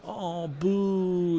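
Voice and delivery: put-on high little-girl "Oh, boo"; lightly voiced pouting daughter; mock disappointed child. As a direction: imitate a pouting kid, light. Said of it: "being upset".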